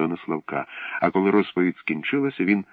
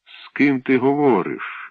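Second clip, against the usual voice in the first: puffed-up; 6 semitones higher, 4 dB louder, slower than usual. A narrator's reading. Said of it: "випнув груди Онопрій".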